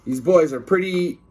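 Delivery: ordinary delivery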